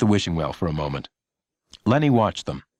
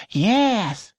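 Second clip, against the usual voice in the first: put-on high, slow child voice; a small girl calling to a stranger; high childish question; curious little girl; 13 semitones higher than usual. A narrator's reading.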